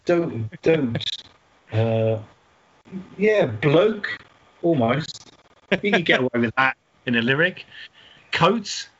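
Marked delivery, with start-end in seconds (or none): none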